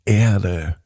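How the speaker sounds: plain reading voice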